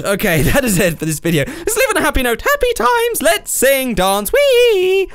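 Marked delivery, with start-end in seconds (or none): 1.5-5.1 playful cheer. Real, not acted. Happy ending of video.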